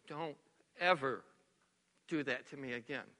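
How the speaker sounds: low, soft, halting hurt; in pieces. Retold or imitated. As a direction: retell a wounded plea, word by word. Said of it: "just in pieces".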